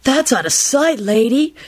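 laid-back hippie approval; approving. A narrator's reading. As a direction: admire her like a groovy flower child. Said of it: "told Caroline approvingly".

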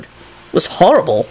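slow, appalled recollection; shuddering. A narrator's reading. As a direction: recall it with an understated shudder. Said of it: "He shuddered".

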